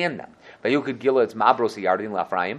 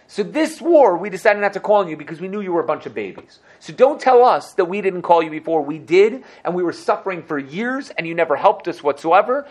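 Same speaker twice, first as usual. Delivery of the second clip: higher, louder indignant rant; an indignant leader defending himself to the tribe, heated; defensive retort; 6 semitones higher, 4 dB louder than usual. Acted. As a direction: push back hard, offended. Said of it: "He said".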